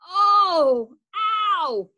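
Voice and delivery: loud high ow of sore muscles; pained cry. Acted. Boy rolls over in bed, cries out in pain.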